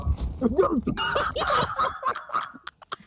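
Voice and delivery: high helpless laughter; laughing fit. Real, not acted. Friend cracks up over a Goliath in the game, high laughter.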